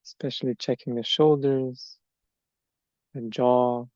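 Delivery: measured meditation guidance, long silences between phrases; guided meditation. Acted. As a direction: let each body part settle before the next. Leads standing meditation.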